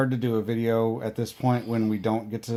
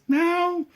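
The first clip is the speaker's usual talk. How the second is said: sudden kitten meow, much higher; toddler meow. Retold or imitated. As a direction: chirp a tiny cat sound like a toddler. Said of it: "our youngest daughter perks up in her chair and just goes".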